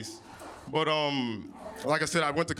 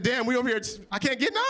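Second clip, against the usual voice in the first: indignant complaint; 9 semitones higher than usual. Retold or imitated. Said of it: "I said".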